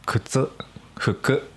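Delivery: 靴 and 服 with Tokyo pitch, high start; 標準語アクセントで「靴、服」; standard accent on kutsu and fuku. Shown as a demonstration. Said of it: "標準語は".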